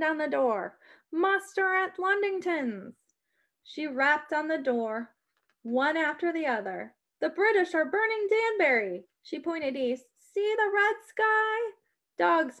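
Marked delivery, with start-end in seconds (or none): 1.1-3.0 calling out. A narrator's reading. Alarm at farmhouse door. 7.2-9.1 calling out. A narrator's reading. Warning the farmers. 10.2-11.7 calling out. A narrator's reading. Pointing east, warning.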